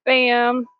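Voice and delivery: ordinary delivery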